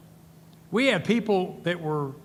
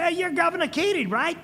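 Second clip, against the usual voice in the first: much higher imitated New York accent; 12 semitones higher than usual. New Yorker at the airport hailing the governor, imitated New York accent. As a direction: play the New Yorker spotting a familiar face. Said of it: "one of these great New York accents".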